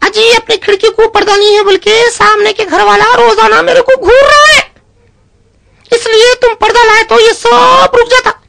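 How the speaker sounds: high, sweet wifely voice; wife's voice. Acted. Wife asking her husband for a curtain, high and sweet. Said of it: "बीवी कह रही है अपने शौहर से".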